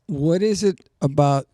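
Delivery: normal talking voice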